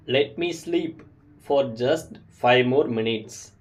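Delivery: slow and clear, for learners to copy; slow model sentence. Shown as a demonstration. Teacher models a sleepy request slowly for learners. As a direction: say it slowly for beginners to repeat. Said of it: "repeat these sentences with me".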